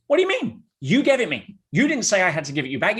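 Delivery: high, loud mock-indignant protest; hammed-up outraged fiancée, starting very high and coming down; imitated upset fiancée. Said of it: "she gets all upset".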